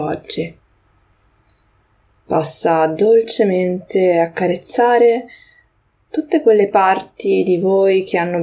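unhurried meditation guiding, long pauses between phrases; guided meditation. Acted. Podcast host leading listeners through a grounding moment, guided meditation. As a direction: guide listeners gently into the breath, leaving space.

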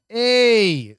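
high, loud bright hey; loud greeting. Shown as a demonstration. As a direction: call it out like an entrance. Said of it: "it's more like that".

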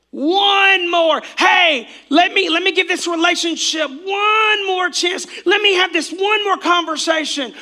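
high, loud whining pleas; whiny excuse-maker. Retold or imitated. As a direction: whine out each excuse.

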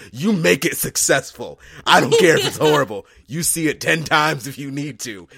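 loud, laughing group rant; loud excited retort. Retold or imitated. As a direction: hype it up, nearly shouting. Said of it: "was like".

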